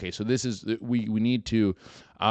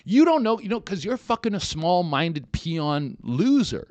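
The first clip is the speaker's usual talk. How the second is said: loud, high, worked-up rant; heated outburst against the small-minded neighbor; indignant rant; 9 semitones higher, 5 dB louder than usual. Real, not acted. Ranting at petty neighbors.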